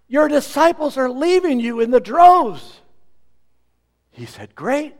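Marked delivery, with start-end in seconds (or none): 0.1-2.8 high, loud urgent news; alarmed report. Retold or imitated. Messengers report to John. 4.5-5.0 high rising delight; glad reply. Retold or imitated. John's joyful reply.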